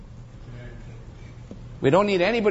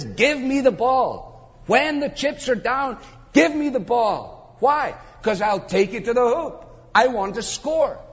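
insistent demand; 6 semitones higher than usual. Acted. Preacher voicing star athlete wanting to score, insistent demand.

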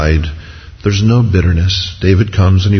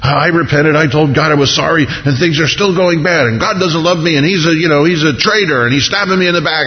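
high-pitched, aggrieved rush of grievances; bitter complaint; 7 semitones higher than usual. Acted. Backslider blaming God when things go bad, bitter complaint. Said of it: "all of this bitterness".

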